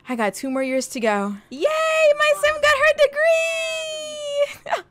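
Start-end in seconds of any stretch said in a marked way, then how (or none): 1.5-4.9 high, slow, crying-joy yell; tearful cheer. Real, not acted. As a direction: cheer through happy tears. Emotional cheer at degree.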